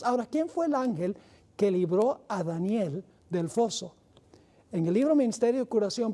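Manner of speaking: usual speaking voice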